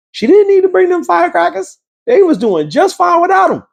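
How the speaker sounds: high, sing-song mocking squeak; mock her in a high whiny voice; hammed-up high mocking tone. Mocking her bringing firecrackers.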